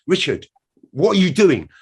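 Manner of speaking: high, annoyed reprimand; exasperated telling-off. Retold or imitated. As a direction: scold him for messing about. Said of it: "everyone's getting angry".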